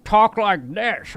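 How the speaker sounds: silly put-on disguise voice; fake voice. Shown as a demonstration. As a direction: demonstrate an obviously fake voice. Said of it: "or give yourself a fake voice".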